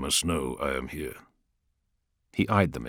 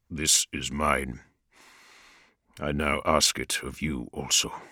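Vulcan explaining his sacrifice to his sons, somber; somber Primarch; 4 semitones lower than usual. Acted. Vulcan's long speech.

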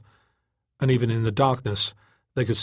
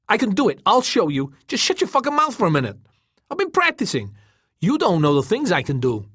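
high, loud, frustrated insistence; heated self-defence; 10 semitones higher, 4 dB louder than usual. A narrator's reading. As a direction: protest hotly to a doubting friend. Defending himself to a mocking friend.

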